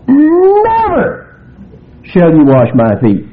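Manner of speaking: "Never" flung out high and long; very strong. Retold or imitated. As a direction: hit "Never" hard and high. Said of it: "very strong".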